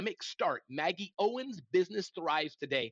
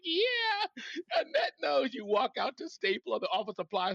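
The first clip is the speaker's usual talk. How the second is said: talking through high laughter; laughing through words; 8 semitones higher than usual. Real, not acted. Host laughs while teasing a listener about typewriters. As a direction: speak while still laughing hard.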